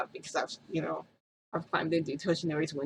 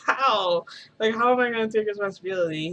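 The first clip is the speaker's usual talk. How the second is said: laughing disbelief; 4 semitones higher, 5 dB louder than usual. Retold or imitated. Pressed to take responsibility.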